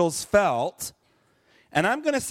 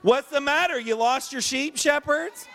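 hammed-up high innkeeper joke; joking innkeeper; 8 semitones higher than usual. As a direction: poke fun at the shepherds in a raised voice. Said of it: "That was a joke".

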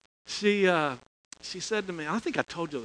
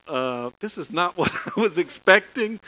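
a startled line broken by a laugh; 4 semitones higher, 6 dB louder than usual. Retold or imitated. Minister caught off guard by a crying student, laughing. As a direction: laugh through the surprise.

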